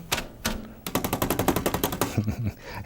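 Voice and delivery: quiet chuckle under breath; soft breathy laugh. Real, not acted. Laugh while shaking brush.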